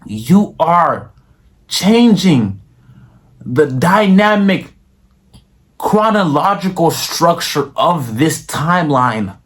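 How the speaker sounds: slow, drawn-out, mock-grand inspirational sermon; mock preacher plea. Vlogger to viewers, urging subscribes like a slow, grand mock sermon. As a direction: hype liking the video like saving the world.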